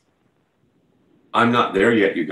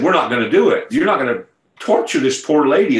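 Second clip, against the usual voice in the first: retold protest, louder and higher; 5 semitones higher, 5 dB louder than usual. Ladies on stage refusing to drop the sick woman, protesting. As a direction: push back, voice raised in mock refusal.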